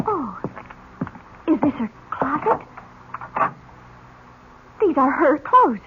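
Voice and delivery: ordinary delivery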